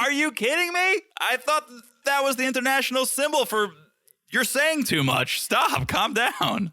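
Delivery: high-pitched, incredulous acted protest; mock exasperation. Retelling the scolding over missed kick signal, mock exasperated.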